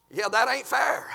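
ordinary delivery

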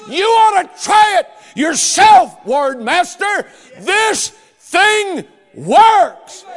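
high, loud, slow triumphant shout; strong, clear voice. A narrator's reading. Dying boy shouting his joy to the ward master. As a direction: proclaim it at full force, rejoicing. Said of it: "with a strong, clear voice".

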